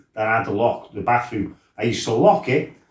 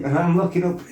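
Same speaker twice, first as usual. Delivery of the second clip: normal talking voice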